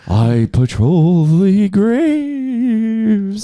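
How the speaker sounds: high, very slow, loud ghostly voice. Acted. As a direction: drag it out like a wailing ghost. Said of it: "That's my ghost voice".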